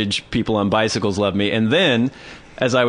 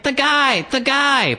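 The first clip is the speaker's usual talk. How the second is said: high, mock wailing child; crying toddler; 14 semitones higher, slower than usual. Storyteller plays a girl dragged off by mother, high mock crying. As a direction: wail it like a dragged-away toddler. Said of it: "She starts crying".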